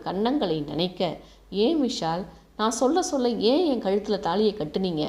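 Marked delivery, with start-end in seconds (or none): none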